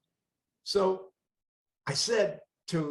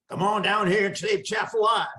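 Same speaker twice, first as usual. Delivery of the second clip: put-on Cajun accent; 5 semitones higher, 4 dB louder than usual. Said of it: "in a thick Cajun agent, uh, accent said".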